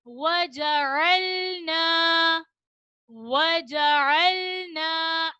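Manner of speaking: slow melodic tilawat with drawn-out vowels; Quran recitation. Shown as a demonstration. Teacher reciting the verse to the children, slow melodic chant. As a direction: chant the verse slowly like a qari. Recites word with rounded lips.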